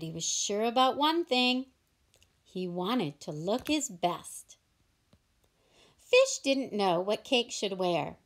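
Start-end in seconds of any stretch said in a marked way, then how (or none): none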